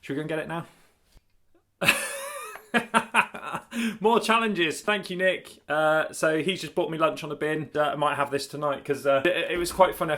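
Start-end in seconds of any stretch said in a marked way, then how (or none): 1.8-5.6 laughing. Real, not acted. Laughs at lunch on a bin.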